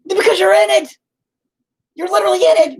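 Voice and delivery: put-on very high, loud yell at the kids; exasperated yell. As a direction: scream it, out of patience. Yelling at the kids.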